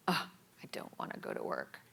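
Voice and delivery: fast whispered grumble; whispered whine. Retold or imitated. Grumbling to herself in bed about work, whispered. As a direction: mutter it under your breath, dreading work. Self-talk whined under the breath.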